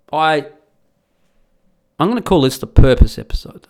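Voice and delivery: ordinary talk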